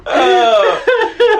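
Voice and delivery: high wheezing laugh, near tears; crying laughter. Real, not acted. Friend cracks up at the Mister Clean joke, crying laughing. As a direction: laugh till you cry.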